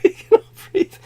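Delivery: squeaky laughing repeat; laughing relief. Real, not acted. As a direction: repeat it cracking up.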